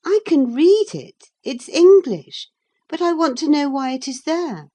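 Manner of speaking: put-on high, loud young girl, proud; girlish high voice. Catherine to Hareton, high loud proud girl.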